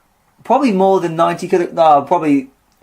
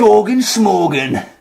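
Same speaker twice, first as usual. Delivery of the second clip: hardman rhyme attempt; 6 semitones higher, slower than usual. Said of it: "That's even worse".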